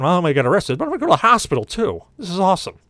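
high, fast, exasperated rant; sarcastic dismay. Retold or imitated. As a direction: pile up the bad news with mock enthusiasm. Ranting inner reaction to looming trouble.

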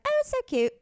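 put-on very high, botched Irish accent; badly faked Irish cooing. Irish guest admires dog in elevator, Irish accent. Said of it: "That's not my Irish accent".

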